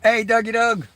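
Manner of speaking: bright, loud hello to a friend; excited greeting. Real, not acted. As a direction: call out a cheerful hey. Greeting a live chat viewer.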